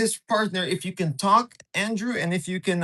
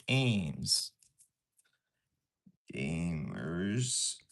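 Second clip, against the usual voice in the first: much lower, soft, stretched out; deep announcer drawl; 12 semitones lower, 8 dB quieter than usual. Pretend. Streamer mock-announcing a viewer's name, deep and slow.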